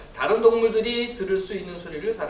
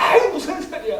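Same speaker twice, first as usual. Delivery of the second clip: high, loud acted yell; bellowed denial, pitched way up; 13 semitones higher, 9 dB louder than usual. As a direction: holler it in angry protest. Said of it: "소리를 고래고래 지르면서".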